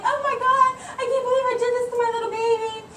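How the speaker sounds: high, laughing mock freak-out, fast; mock panic. Mom retelling poking her baby's eye, laughing mock panic. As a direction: play up the panic, half laughing. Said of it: "I was freaking out".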